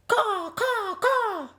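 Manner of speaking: high squawking bird cries; puffin caws. Acted. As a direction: squawk like panicked seabirds. Startled puffins crying out.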